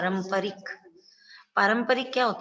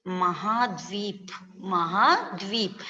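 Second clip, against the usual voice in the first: महाद्वीप said slowly, pressing the half द; stress on the half letter; slower than usual. Shown as a demonstration. Teacher drilling pupils: महाद्वीप slowly, pressing the half द. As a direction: lean on the द in महाद्वीप, slow and careful. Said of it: "जोर लगाना है".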